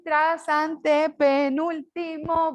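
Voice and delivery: slow, stretched "trasantepenúltimo", syllable by syllable; silabeo didáctico. Shown as a demonstration. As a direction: spell out "trasantepenúltimo" slowly for a class, each syllable held. Profesor silabea el término nuevo. ("trasantepenúltimo" dicho despacio, sílaba por sílaba)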